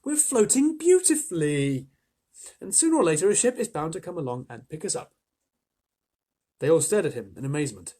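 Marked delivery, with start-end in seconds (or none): 0.0-5.1 calm them with a bright promise; reassuring boy. A narrator's reading. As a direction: James assuring the bugs of rescue. James reassures the group.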